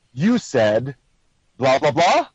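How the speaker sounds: statement turned up into a question on the last "blah"; questioning uptone. Shown as a demonstration. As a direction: let "blah, blah, blah" climb at the end like a question. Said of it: "you go up at the end".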